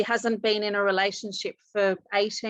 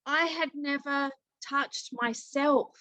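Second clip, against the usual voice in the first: higher, emotional quote of a crying friend; halting, raised voice, lightly tearful; retold tearful confession; 5 semitones higher, slower than usual. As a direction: imitate her tearful admission. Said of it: "she was crying, I was crying".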